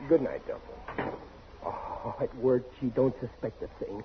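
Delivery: sneaky whisper so the wife won't hear. Acted. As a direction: whisper to yourself, relieved. Whispering after wife goes to bed.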